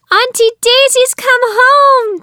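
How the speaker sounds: high, loud panicked cry from the nieces; alarmed gasp. A narrator's reading. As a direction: gasp it out high, caught red-handed. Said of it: "they gasped".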